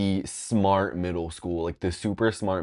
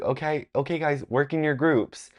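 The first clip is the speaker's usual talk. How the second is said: higher, sweet teacher voice; mock teacher on best behavior; 7 semitones higher than usual. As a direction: play the teacher, overly nice for the observers. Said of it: "she was like".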